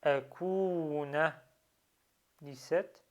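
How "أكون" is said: أكون with a long held "uu"; prolonged vowel. Shown as a demonstration. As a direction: stretch the waw in أكون, then a short "na". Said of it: "Donc je prolonge".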